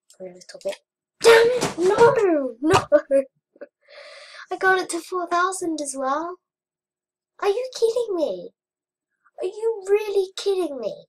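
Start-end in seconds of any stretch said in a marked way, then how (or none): 1.2-3.2 loud, high outburst of dismay; frustrated yelling. Real, not acted. Gamer losing a high score, frustrated yelling. Dies in the game. 4.5-11.1 high, loud disbelief; outraged protest. Real, not acted. Gamer who got to four thousand and lost, outraged protest.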